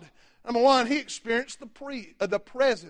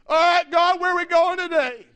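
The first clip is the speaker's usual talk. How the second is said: full-force preaching; 5 semitones higher than usual. Real, not acted. Preacher denouncing evil to his congregation, full-force preaching. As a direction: drive every phrase home to the congregation.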